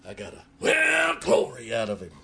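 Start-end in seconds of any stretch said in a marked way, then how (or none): 0.6-1.7 put-on high, loud revival whoop from an old preacher; imitated glory shout. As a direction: burst into a sudden preacher's shout. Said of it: "started shouting".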